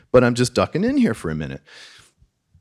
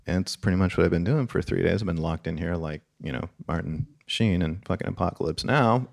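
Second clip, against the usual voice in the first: lower, much softer deadpan; a hint of weary sarcasm; mock deadpan; 6 semitones lower, 8 dB quieter than usual. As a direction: mumble it flatly to the boss. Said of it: "And I'm like".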